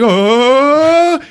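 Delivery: high, loud shocked oh; hammed-up fright; startled yelp. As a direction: yelp like you've seen a monster. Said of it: "And he's like".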